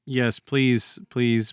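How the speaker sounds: normal talking voice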